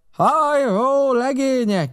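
high, loud hail to the crew; calling out. A narrator's reading. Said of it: "kiáltotta". (magas hangú, hangos kiáltás)

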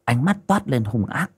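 usual speaking voice

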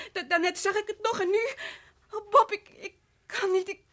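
a sob breaking through; crying out. Acted. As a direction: cry out his name. Wife cries out to husband.

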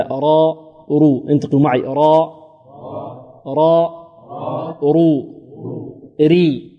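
"را، رو" براء مفخمة و"ري" براء مرققة; انطق مقاطع الراء ببطء للتلقين: را، رو، ري; نطق نموذجي للراء. Shown as a demonstration. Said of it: "نقول هكذا". (فخّم الراء في "را" و"رو" ورققها في "ري")

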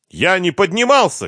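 high-pitched protesting cry. A narrator's reading. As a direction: cry out in denial. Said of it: "крикнул Марик".

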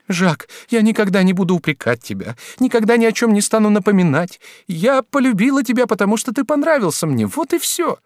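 high, earnest woman's voice; pleading young mistress. A narrator's reading. Woman promises lover never to reproach him, pleading. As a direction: plead upward, loud and insistent.

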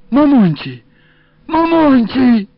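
high, loud keening call, drawn out at the end; wailing lament. A narrator's reading. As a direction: wail the name like a lamenting woman. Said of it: "запричитала женщина". (громкое высокое причитание)

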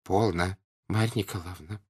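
subdued, slightly hushed husband; quiet voice. A narrator's reading. Husband addresses his wife before a stranger, quiet voice. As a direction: hush her gently, keeping your voice down. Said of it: "тихим голосом обратился муж к жене".

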